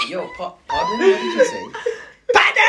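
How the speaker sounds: very high, loud hammed-up outburst; mock angry dad. Mocking how dad shouts pardon, high hammed-up yell. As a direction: overdo the outraged dad. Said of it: "he's used the word like that".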